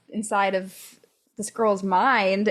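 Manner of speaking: ordinary talk, nothing marked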